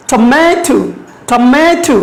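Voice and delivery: Tomato with the middle syllable stressed and held. Shown as a demonstration. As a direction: lean on the "ma" in Tomato. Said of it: "we stress on ma".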